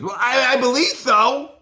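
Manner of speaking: high, loud mocking imitation of her; squeaky put-on voice mocking the witness. Mocking her court answer.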